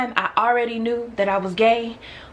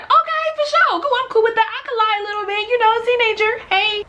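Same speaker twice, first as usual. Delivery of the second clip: put-on squeaky, over-bright teenage girl; high eager teen voice. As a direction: chirp it like a fourteen-year-old going along. Teen self eagerly agrees to lie.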